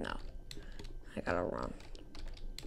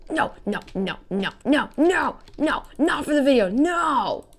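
loud rising panicked cries; 8 semitones higher, 8 dB louder than usual. Real, not acted. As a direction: yell no in panic. Slips during recording.

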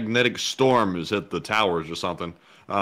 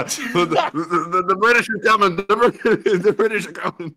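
high-pitched alarm broken by laughter; laughing mock warning; 9 semitones higher, 4 dB louder than usual. Mock Paul Revere warning, laughing.

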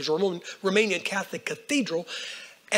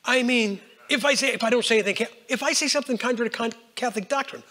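ordinary talk, nothing marked

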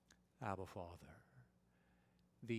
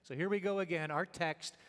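ordinary delivery

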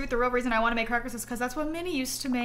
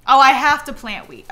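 loud realization; 11 dB louder than usual. Real, not acted. Realizing the quest task.

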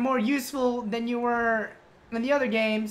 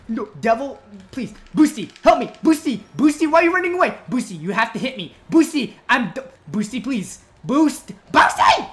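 desperate calling; 2 semitones higher, 9 dB louder than usual. Real, not acted. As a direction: call the pet for help, desperate. Desperately calling his Palico for help.

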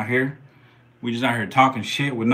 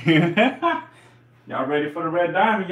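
laughing drawl; 7 semitones higher, 3 dB louder, much slower than usual. Real, not acted. Drawn-out laughing hype.

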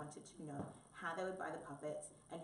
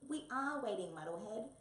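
loud, raised, exasperated cry; impatiently; 5 semitones higher, 6 dB louder than usual. A narrator's reading. Tabby hurries Wombat along, crying impatiently. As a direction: cry out as if fed up waiting. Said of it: "cried Tabby impatiently".

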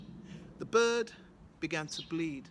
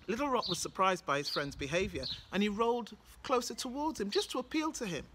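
plain reading voice

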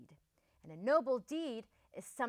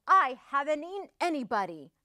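quick, loud, raised protest; howled protest; 4 semitones higher, 6 dB louder, faster than usual. A narrator's reading. Wolf shut out by Missus Duck, protesting in a mild howl. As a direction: protest loudly and indignantly. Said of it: "he howled".

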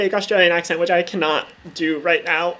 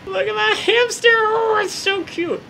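squealed much higher, gushing; gushing over hamster; 13 semitones higher than usual. Real, not acted. Gamer gushing to her pet hamster, squealing high.

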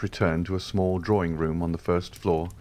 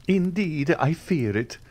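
higher, theatrical sigh of love; young man aping a Comédie actor; stage lover's tone; 10 semitones higher than usual. A narrator's reading. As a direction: play the lovesick stage hero. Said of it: "in the tone ... at the Théâtre Français".

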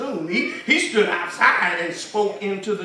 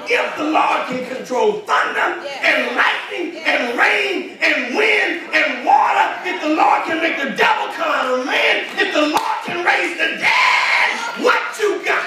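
louder, higher, shouted sermon climax; full-throated preacher build; preaching at full force; 6 semitones higher, 3 dB louder than usual. Acted. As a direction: drive it home to the church with rising fire. Preaches the closing exhortation.

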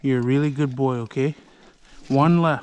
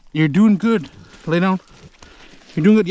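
louder, higher praise for a good dog; encouraging praise; 4 semitones higher, 6 dB louder than usual. Real, not acted. Owner praising his dog while pulling porcupine quills, bright and louder. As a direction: cheer the hurt dog on, upbeat.

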